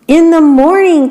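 morning called out high; excited cry. A narrator's reading. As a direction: cry it out together like eager beetles. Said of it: "cried all the other beetles".

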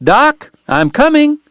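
high-pitched call across the mob; urgent cry of rescue, pitched high and slow; shout. A narrator's reading. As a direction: yell to a friend in a brawl.

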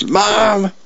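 high-pitched mock yell for Mom; acted yell. [name] comes up behind her mom, mock yelling. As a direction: call out like a kid yelling for a parent. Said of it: "comes up behind her mom and yells".

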